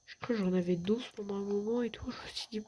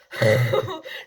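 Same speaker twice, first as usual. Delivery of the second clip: nervous laugh; 11 semitones lower, 11 dB louder than usual. Real, not acted. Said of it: "J'ai eu la peur de ma vie".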